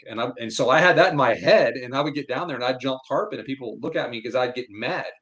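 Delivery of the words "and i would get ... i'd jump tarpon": ordinary talk, nothing marked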